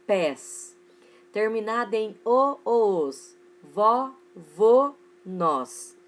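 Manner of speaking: "pás", "já", "fé", "vô" each punched loudly on its own; stressed monosyllables. Shown as a demonstration. Teacher drilling accented one-syllable words for pupils, stressed monosyllables. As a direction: list "lê", "pés", "vó", "nós" as separate, emphatic open beats. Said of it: "acentua-se os monossílabos tônicos".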